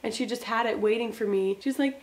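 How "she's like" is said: normal talking voice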